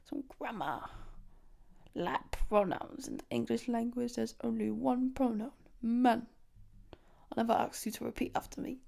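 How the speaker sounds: imitating a roadman teacher laying down grammar; mock London teacher. Retelling a teacher teaching pronouns, in mock London slang. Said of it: "And then he's like".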